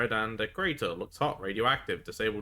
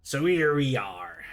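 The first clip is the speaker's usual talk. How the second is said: deflated pirate; 5 semitones higher, 6 dB louder, slower than usual. Acted. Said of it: "Slumps down".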